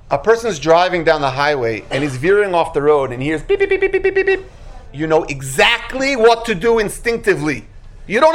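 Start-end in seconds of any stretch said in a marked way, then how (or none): 3.6-4.4 high, rapid alarm beeps; imitated warning beeps. Car warns driver veering off the road, imitated beeping. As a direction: mimic a dashboard alert, high-pitched.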